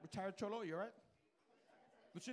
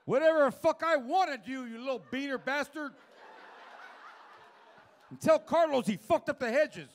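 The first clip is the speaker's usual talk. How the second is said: put-on cranky old guy; 7 semitones higher, 10 dB louder than usual. Old man answers crudely.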